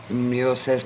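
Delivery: usual speaking voice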